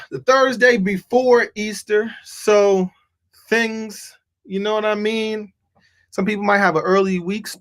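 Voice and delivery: ordinary talk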